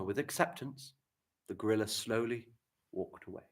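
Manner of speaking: softly resigned gorilla sentence; calm acceptance. Shown as a demonstration. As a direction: let it go quietly, accepting.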